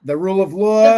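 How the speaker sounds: loud, high-pitched New York accent imitation; hammed-up New York "law", big and loud. Co-host asks him to do Cohen's accent, exaggerated New York imitation. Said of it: "can you do it?".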